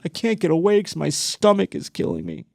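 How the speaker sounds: lightly put-on voice of a man in agony; pained whine. Robber stuck with a stomach ache, mock pained whine.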